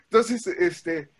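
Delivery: ordinary talk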